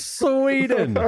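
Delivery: very high, drawn-out "Sweden"; singsong high-pitched teasing; mocking echo. Mock echo of the guess.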